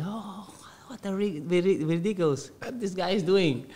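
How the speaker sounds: high, softened mock astonishment; mocking kids. Kids laughing at the newcomer on the pitch, mocking. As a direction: imitate scoffing children, pitched well up. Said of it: "all the, the kids was laughing to me".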